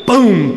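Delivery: punchy spoken boom; mock boom. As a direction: slam the word down as a sound effect. Sound effect after command.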